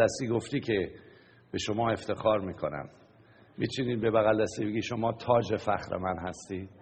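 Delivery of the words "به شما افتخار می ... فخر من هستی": normal talking voice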